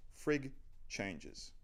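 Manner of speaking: ordinary talk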